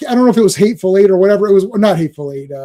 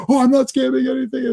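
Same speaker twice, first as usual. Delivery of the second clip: higher-pitched imitation of an indignant scammer; mock angry; 6 semitones higher than usual. Accused phone scammer denying it to the caller, mock angry. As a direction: protest like a riled caller, lightly hammed-up. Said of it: "he's getting angry".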